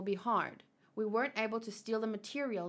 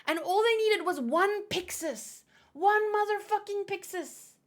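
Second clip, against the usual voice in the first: excited rant; 11 semitones higher, 7 dB louder than usual. Real, not acted. Excited rant about a character.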